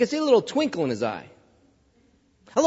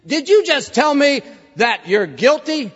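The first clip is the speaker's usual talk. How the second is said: incredulous judge; 7 semitones higher than usual. Retold or imitated. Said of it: "And the judge says".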